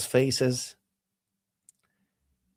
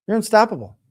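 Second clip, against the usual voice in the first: emphatic; 7 semitones higher, 4 dB louder, faster than usual. Real, not acted. Repeated louder for emphasis.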